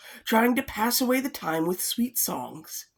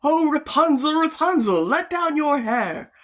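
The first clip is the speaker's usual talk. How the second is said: calling out; 6 semitones higher, 4 dB louder than usual. Acted. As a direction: call up to the tower. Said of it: "he went to the tower and cried".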